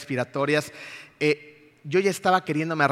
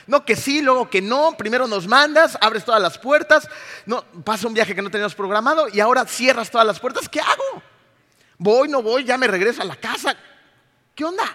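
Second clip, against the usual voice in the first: louder, higher complaint; reproaching God; 7 semitones higher, 6 dB louder than usual. Retold or imitated. Weary traveller openly reproaching God, loud and higher. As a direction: demand answers from God. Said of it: "le estaba reclamando abiertamente".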